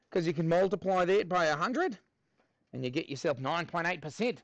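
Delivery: put-on Australian accent; Aussie accent. Maths teacher asking students for help, put-on Aussie accent. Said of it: "we'll do this in an Aussie accent".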